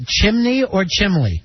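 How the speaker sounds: "chimney" with N, then "chimley" with L; both pronunciations contrasted. Shown as a demonstration. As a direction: set "chimney" against "chimley". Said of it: "With an L in there?".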